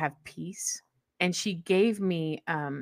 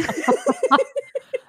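a high, loud burst of laughter; high-pitched laugh; 5 dB louder than usual. Real, not acted. Guest laughing at her teacher's scary Facebook posts, high and loud. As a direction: laugh high at your own joke.

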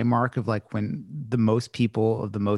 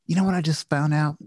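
put-on Southern accent; 5 semitones higher than usual. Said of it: "he was really thick Southern accent".

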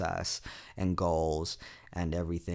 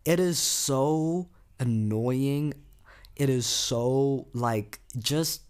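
higher, louder, slow exasperation; exasperated rant about power cuts; 6 semitones higher, 4 dB louder, much slower than usual. Real, not acted. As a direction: drag it out, fed up. Venting about load shedding.

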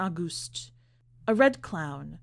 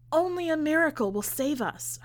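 lightly voiced mother, pitched higher; a hint of a fretful mother, up high; worried mother; 7 semitones higher than usual. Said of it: "her mother said".

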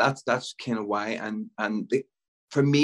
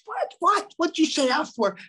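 very high, incredulous squawk; hammed-up high-pitched protest; indignant dad. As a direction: imitate a taken-aback dad, pitch shooting up. Acts out dad's startled objection.

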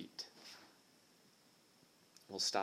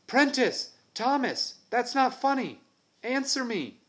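high, loud panicked shout; frightened shouting; 14 semitones higher, 7 dB louder than usual. Acted. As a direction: yell as fear takes hold. Boy alone, friends vanished.

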